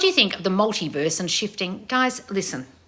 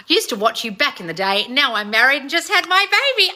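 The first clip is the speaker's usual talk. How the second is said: rising higher and louder to a squeal; mounting excitement; 7 semitones higher, 6 dB louder than usual. Real, not acted. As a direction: read out great news, building to a thrilled peak. Reads fan's baby news, thrilled.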